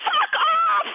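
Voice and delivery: high-pitched, full-out yell; re-enacted furious shout; retold driveway yell. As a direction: holler it across the driveway. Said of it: "I yelled in my driveway".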